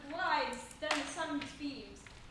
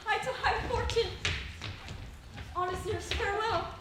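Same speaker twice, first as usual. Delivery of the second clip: loud, higher, joyful exclamation; elated farewell; 4 semitones higher, 5 dB louder than usual. Acted. Juliet thanks her nurse and leaves, elated farewell. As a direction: cry out happily as you leave.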